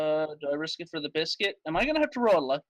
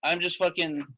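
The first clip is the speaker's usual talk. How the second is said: normal talking voice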